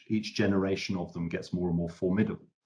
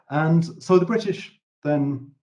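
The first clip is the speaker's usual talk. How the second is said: ordinary talk, nothing marked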